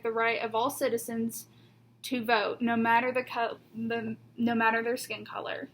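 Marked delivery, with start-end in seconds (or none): none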